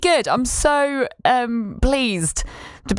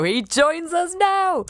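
mock announcer; 8 semitones higher, much slower than usual. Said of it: "announcer line".

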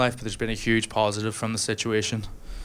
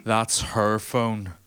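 slow apologetic giant; much slower than usual. Retold or imitated. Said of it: "and he goes".

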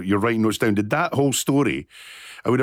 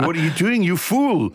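mock scolding; 6 semitones higher than usual. Host mock scolds comedian.

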